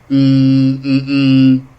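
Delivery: loud low disapproving hum. Acted. Comic mimicking a leering Biden with a loud hum.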